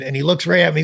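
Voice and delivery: normal talking voice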